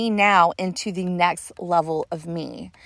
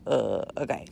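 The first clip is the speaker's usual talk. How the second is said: low, soft hypnotized mumble; limp and lax; 8 semitones lower, 6 dB quieter than usual. Retold or imitated. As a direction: sound heavy and slack, deep under hypnosis.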